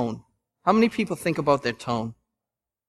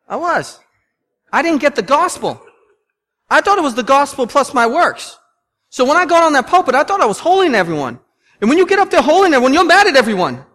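much higher, louder, fast preaching; high, loud pulpit fervour; full-force preaching; 9 semitones higher, 8 dB louder than usual. Real, not acted. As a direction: preach it with rising fire.

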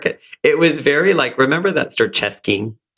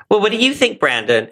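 mock bright teen girl; 9 semitones higher than usual. Mimicking Brenda asking brother.

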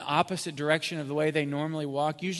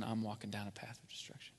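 hushed whisper, a little faster; whispered confession; 5 semitones lower, 14 dB quieter, faster than usual. Retold or imitated. Preacher voicing a listener admitting his own ruin, whispered. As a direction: breathe it out like a secret admission.

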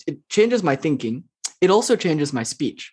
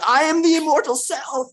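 higher, grand self-proclaiming tone; mock pompous declaration; 11 semitones higher than usual. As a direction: proclaim it like a self-important guru. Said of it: "grab someone by the shoulder and say".

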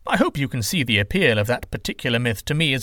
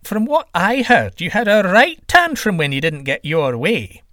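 higher, louder needling tease; sly taunting trickster; 7 semitones higher, 5 dB louder than usual. Acted. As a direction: rub his nose in the rumour. Trickster taunting about a tantrum.

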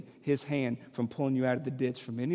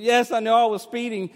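higher, louder imitation of his crying wife; tearful admission; 10 semitones higher, 12 dB louder than usual. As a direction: answer the cop through tears. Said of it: "And she's crying".

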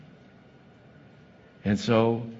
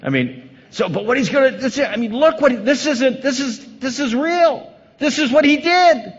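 usual speaking voice

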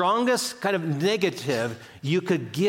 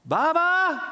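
put-on high child's voice calling out; grandson's desperate cry; 11 semitones higher, much slower than usual. Grandpa mimics grandson calling for him. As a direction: call out like a worried little boy. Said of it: "desperate cry".

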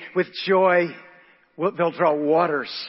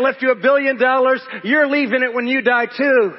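raised, emphatic pulpit voice; forceful preaching; 7 semitones higher, 6 dB louder than usual. Real, not acted. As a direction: preach it out to the congregation.

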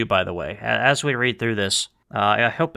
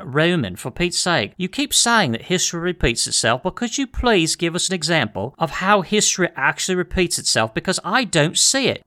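higher-pitched, pleading complaint; exasperated listener; 5 semitones higher than usual. Acted. Skeptical listener asking the host for an example, exasperated. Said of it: "some people always ask the question".

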